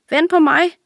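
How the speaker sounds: high, loud call from behind, out of breath; breathless shout. A narrator's reading. Friend running to catch up, calling out breathlessly. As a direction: shout after them to wait. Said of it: "råber en forpustet stemme".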